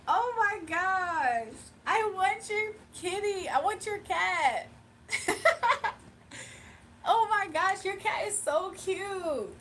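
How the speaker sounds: high, slow, squealing over a cute cat; gushing over a cat. Real, not acted. Gushing over viewer's cat photo.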